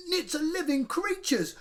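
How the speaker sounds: high, loud howl of protest. A narrator's reading. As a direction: howl it like a wronged child. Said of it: "howled Henry".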